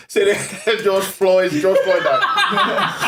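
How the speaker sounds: slow, laughing through the words; comic cracking up over his own joke; laughing aside. Real, not acted. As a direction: giggle while dragging it out. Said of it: "joking aside".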